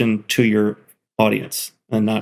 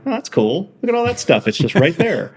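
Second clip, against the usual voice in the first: light, high wonder at seeing it work; delighted; 7 semitones higher than usual. Retold or imitated. Builder reacting to each finished website, delighted. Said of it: "it's still delightful for me".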